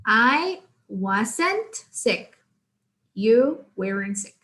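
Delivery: very slow, each word drawn out; slow model sentence. Shown as a demonstration. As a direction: spell it out slowly for learners.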